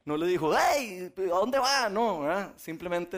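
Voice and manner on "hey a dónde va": sharp, higher, louder scold; preacher mocks a scolding GPS, higher and louder. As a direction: play an irritated GPS snapping at a lost driver. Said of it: "el G P S no se enojó".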